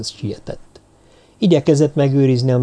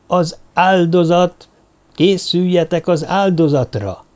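drawn-out, higher ceremonial call; proclaiming cry; 5 semitones higher than usual. A narrator's reading. Mage calling the druids to the sacrifice, crying out. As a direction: call it out like an announcement, pitched up. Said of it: "és felkiáltott".

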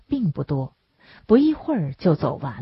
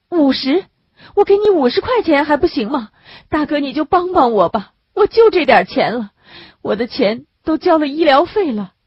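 higher, louder begging mother, quickened; desperate pleading woman; 9 semitones higher, 5 dB louder than usual. A narrator's reading. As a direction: beg him urgently, higher and louder. Narrator voices desperate woman.

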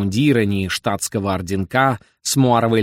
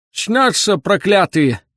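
put-on high, drawn-out old man's wail; old man's lament; 9 semitones higher, 4 dB louder, slower than usual. A narrator's reading. Old man wails about cursed dreams, high and drawn out. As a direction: cry it out slowly from afar. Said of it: "донёсся до Остапа голос старика".

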